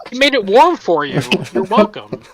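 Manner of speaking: high, loud teasing voice. Acted. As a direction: tease him brightly.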